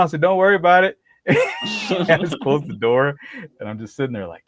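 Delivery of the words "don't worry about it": higher, louder, offhand; loud breezy brush-off; calm reassurance called out. Retold or imitated. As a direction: shout it back casually. Answering girlfriend over commotion.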